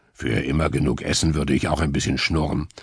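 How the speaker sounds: low boar voice. A narrator's reading. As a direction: play the greedy boar. Boar character voice.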